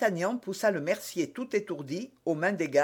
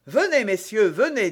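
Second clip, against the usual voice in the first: higher, louder public call; 5 semitones higher, 6 dB louder than usual. A narrator's reading. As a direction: call them over openly. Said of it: "dit tout haut d'Artagnan". (à voix haute, pour être entendu)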